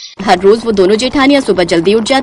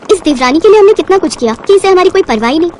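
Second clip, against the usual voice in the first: ordinary delivery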